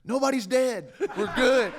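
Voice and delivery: high, loud, laughing it off; laughing reassurance. Retold or imitated. Son laughing to calm his worried mom on the phone. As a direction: reassure her through a laugh.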